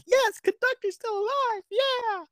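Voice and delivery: very high, squealing celebration; hammed-up delight at good news; overjoyed cheer. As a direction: cheer in a tiny, sky-high voice. Acting out own joy at news.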